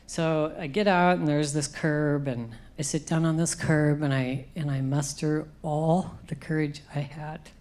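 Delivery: usual speaking voice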